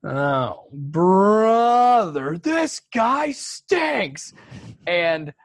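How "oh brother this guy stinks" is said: drawn-out, high-pitched cartoon heckle; heckler's yell. Some guy yelling from the background at stand-up comedy, mock yell. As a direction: imitate a loud, stretched-out yell from the crowd. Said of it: "some guy just yells".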